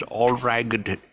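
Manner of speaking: "all ragged" as all-RYE-gut; G sounded. Shown as a demonstration. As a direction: mistaken hard G in "all ragged". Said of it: "heard it pronounced as".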